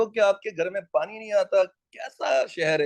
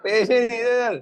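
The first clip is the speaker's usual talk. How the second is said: higher, mildly plaintive excuse; lightly acted broke friend; 5 semitones higher, 4 dB louder than usual. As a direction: answer a friend as if hard up. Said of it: "पैसे, हाँ ये बोलना है आप लोगों ने".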